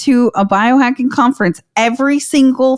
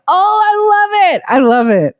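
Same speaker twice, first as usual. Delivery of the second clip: sarcastic; 8 semitones higher than usual. Real, not acted. Sarcasm about being attacked.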